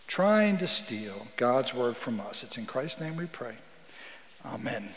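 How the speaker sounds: prayerful, reverent opening prayer. Acted. Pastor opening the sermon in prayer, reverent prayer voice. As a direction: pray it over the congregation.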